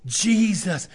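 "Jesus" called out high and loud; with conviction. Retold or imitated. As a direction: proclaim "Jesus" like you mean it. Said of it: "say it like you mean it".

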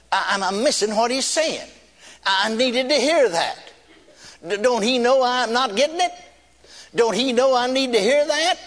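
high-pitched, pleading bewilderment; retold near-panic. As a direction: relive anxious thoughts, pitch climbing question by question. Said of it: "And I almost panicked".

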